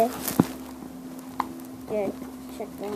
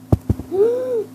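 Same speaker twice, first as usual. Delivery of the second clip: sharp gasp; 12 semitones higher, 4 dB quieter than usual. Real, not acted. Sudden gasp.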